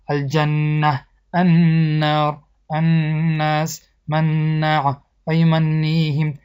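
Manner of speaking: slow recitation, stretching each n through the nose in النار، الناس; nasal two-count ghunna. Shown as a demonstration. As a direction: hold the doubled n in الجنه، النار، الناس، منع، یمنیهم as a nasal hum. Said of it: "با آواز دماغ به مقدار دو حرکت کشیده".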